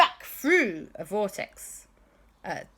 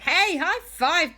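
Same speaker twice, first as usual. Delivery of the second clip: higher, louder, drawn-out cheer; excited greeting; 10 semitones higher, 6 dB louder, slower than usual. Acted. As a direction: stretch out the high five. Paul greeting Doctor Who.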